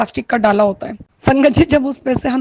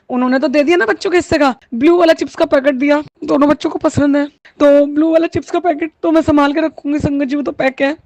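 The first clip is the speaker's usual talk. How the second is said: ordinary talk, nothing marked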